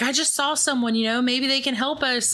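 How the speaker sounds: light imitation of a spooked but hopeful friend; urgent excited report. Sarah tells Juno about figure.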